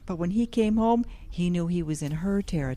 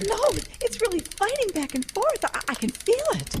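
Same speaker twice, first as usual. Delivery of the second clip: excited strain; 9 semitones higher than usual. Acted. Fighting a fish near the boat, urgent and excited. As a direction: keep the urgency up.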